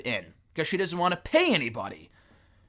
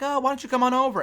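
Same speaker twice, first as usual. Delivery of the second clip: sweet fake invite; 9 semitones higher, faster than usual. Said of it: "and be like".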